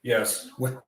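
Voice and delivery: ordinary talk, nothing marked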